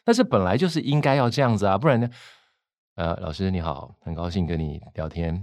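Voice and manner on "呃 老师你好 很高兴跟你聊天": much softer, lower, sleepy tone; late-night radio voice. Shown as a demonstration. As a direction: murmur like a midnight radio host. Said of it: "这个深夜节目可以". (深夜节目般低沉轻柔)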